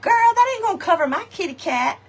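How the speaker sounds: high mock sister voice; imitating sister. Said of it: "my sister says".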